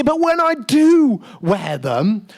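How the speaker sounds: ordinary talk, nothing marked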